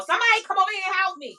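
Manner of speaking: high, fast call across the room; high-pitched holler for help. Real, not acted. Calling out to others for help.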